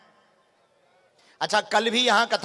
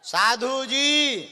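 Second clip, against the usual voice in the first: drawn-out call; 5 semitones higher, 4 dB louder, much slower than usual. Real, not acted. Calling someone from stage.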